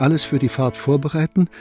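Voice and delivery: normal talking voice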